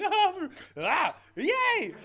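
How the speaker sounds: very loud, high mock screams into a cheer; shriek, then cheer wildly, hammed-up; comic frenzy of fright and joy; hysterical outburst. Acts out emotional mess after walkthrough.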